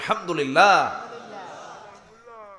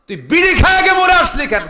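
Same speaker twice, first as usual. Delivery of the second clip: high, loud reproach building to a shout; acted angry rebuke; 10 semitones higher, 9 dB louder than usual. Preacher voicing God questioning a dead smoker, acted shouted rebuke. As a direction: demand an answer, rising to full shout.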